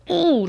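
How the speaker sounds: high-pitched "ooh" at a hurt hand; imitated "ooh". Retelling looking at her hurt hand, high imitated ooh. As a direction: let out a high little ooh. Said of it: "like this".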